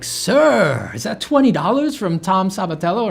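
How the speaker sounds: high, loud, fawning waiter voice. Retold or imitated. Waiter greeting a diner with a donation, high and fawning. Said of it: "Italian waiters will walk right up to me and be like".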